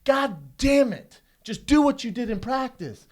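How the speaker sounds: loud, slow retold yelling; mock angry yell. Dad scolding his daughter on the course, loud mock anger. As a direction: drag out the curse like an angry dad. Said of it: "He's going".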